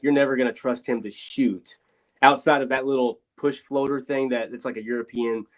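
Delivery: normal talking voice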